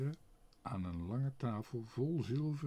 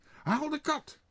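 nog hoger, dringende roep; roepend; 5 dB louder than usual. A narrator's reading. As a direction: herhaal het nog dwingender. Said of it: "riep tegen zijn vrouw". (meneer Pardoes roept nog hoger en dringender naar zijn vrouw)

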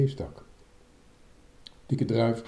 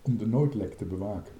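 usual speaking voice

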